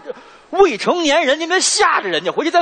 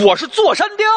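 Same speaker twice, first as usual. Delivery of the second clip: bandit chief; 10 semitones higher than usual. Pretend. Bandit chief announcing himself to the hero, proud high declaration. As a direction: announce yourself as the mountain king.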